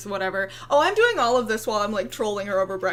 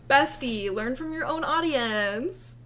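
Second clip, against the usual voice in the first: usual speaking voice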